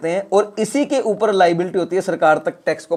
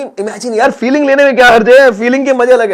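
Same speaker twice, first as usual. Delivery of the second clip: loud, high, eager push; excited urging; 5 semitones higher, 7 dB louder than usual. Real, not acted. Teacher urging the class to enjoy the feeling, loud and excited. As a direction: pump the class up to imagine it.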